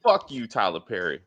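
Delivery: low imitated bottle voice; talking ketchup bottle. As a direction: voice the bottle, pitched well down. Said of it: "the ketchup bottle that they exploded behind her to be like".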